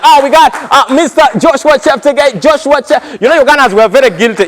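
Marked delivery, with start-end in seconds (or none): 0.0-3.0 excited interviewer. Acted. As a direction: call the name out eagerly. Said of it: "They're like".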